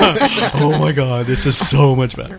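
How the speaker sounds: mock relieved sigh; imitating a relieved friend. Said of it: "You could hear the relief in his voice".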